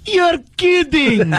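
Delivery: high, slow, laughing gasp of surprise; mock shocked victim. Cohost plays the stunned prank victim, high mock shock.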